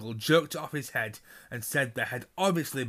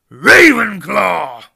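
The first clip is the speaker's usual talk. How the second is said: huge, slow booming shout, much higher; hat shouts; 8 semitones higher, 20 dB louder, much slower than usual. A narrator's reading. The Sorting Hat announces Hannah's house with a booming shout. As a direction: bellow the house name to the whole hall.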